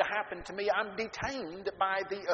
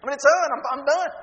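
higher and louder, desperate; panicked despair; 7 semitones higher, 12 dB louder than usual. Retold or imitated. Teen panics about being arrested, loud and high. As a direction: cry out that it's all over.

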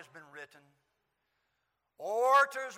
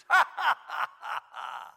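mocking laugh; 6 semitones higher, 6 dB louder than usual. Soldiers mocking Jesus.

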